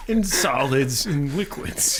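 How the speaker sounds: put-on slow, heavy Austrian action-hero accent; mock Austrian muscleman drawl; Arnold Schwarzenegger voice. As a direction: drag out the words like Schwarzenegger. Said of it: "sound like Arnold Schwarzenegger".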